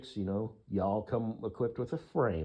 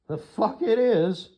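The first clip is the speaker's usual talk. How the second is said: loud protest; 11 semitones higher, 9 dB louder, slower than usual. Real, not acted. Angry rebuttal of mocking look.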